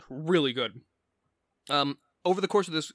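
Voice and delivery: ordinary talk, nothing marked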